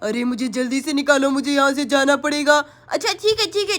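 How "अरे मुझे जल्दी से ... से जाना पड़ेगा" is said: much lower, impatient witch. Acted. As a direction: hurry them as the witch. Witch urges rescue.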